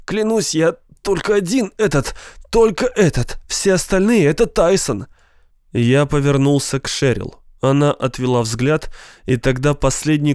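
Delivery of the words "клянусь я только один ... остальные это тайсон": high, urgent swearing through pain; pained pleading. A narrator's reading. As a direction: beg to be believed, hurting. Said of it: "воскликнул он и скривился от боли".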